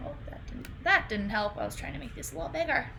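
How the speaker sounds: usual speaking voice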